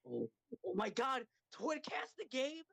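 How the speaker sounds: high-pitched fanboy gasping at a reveal; mock amazement. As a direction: play up the thrilled disbelief. Acted reaction to game announcement.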